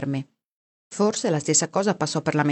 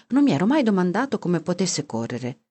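plain reading voice

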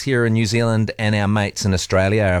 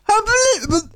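much higher, strained voiceless rasp; Harry Kane impression. Host copying the captain's post-match speech, high strained rasp. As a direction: squeeze out the words like a wrecked voice. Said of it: "trying to imitate Harry Kane".

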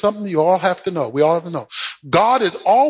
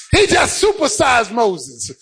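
fervent, driving pulpit delivery; exultant sermon cadence; rousing preaching climax; 7 semitones higher than usual. Real, not acted. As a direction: preach it home with rising urgency.

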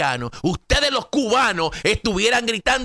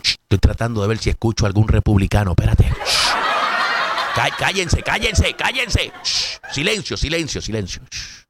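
mock shushing; 4 semitones lower than usual. Pretends to listen for republicans.

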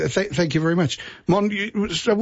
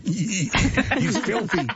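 slow, higher, laughing mock verdict; amused parents' aside; 5 semitones higher, much slower than usual. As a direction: play a scandalised parent through laughter. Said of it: "but the parents are going".

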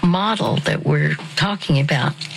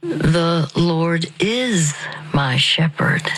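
a calm, unhurried recitation; very slowly; slower than usual. Shown as a demonstration. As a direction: breathe it out word by word, slowly. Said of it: "You say it very slowly".

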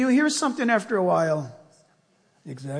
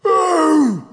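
drawn-out mock roar, higher and louder; lion roar imitation; 6 semitones higher, 6 dB louder than usual. Preacher playing the lion to his congregation, mock roar. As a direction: growl it out like a lazy lion. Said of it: "And he's going".

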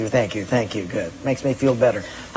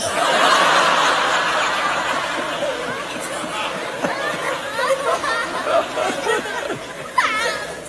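long laugh; 5 dB quieter than usual. Real, not acted.

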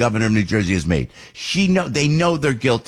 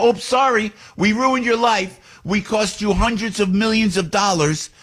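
sarcastic mock apology; 8 semitones higher than usual. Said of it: "uh, you know".

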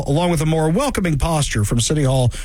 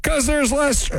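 high-pitched sarcastic agreement; sarcastic, high-voiced reasoning; mock agreement; 9 semitones higher than usual. Mockingly agrees with the mayor's claim.

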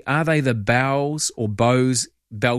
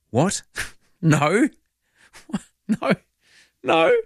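high, laughing no, no, no; stunned laughing denial; laughing disbelief; 6 semitones higher, slower than usual. Retold or imitated. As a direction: laugh it off in shock. Shocked at crocodile warning.

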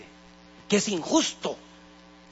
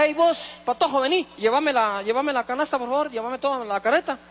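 high, loud bossy yell across the store; man yelling; 7 semitones higher, 4 dB louder than usual. Retold or imitated. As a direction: bark orders at the boy. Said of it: "un señor te grita".

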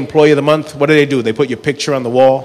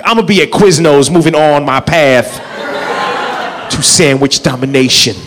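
grandiose ambition; slower than usual. Retold or imitated. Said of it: "I'm like".

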